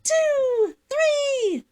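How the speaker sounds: rising squeal on two and three; excited countdown. Real, not acted. Counting down to the costume reveal, excited squeal. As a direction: build up to a high squeal.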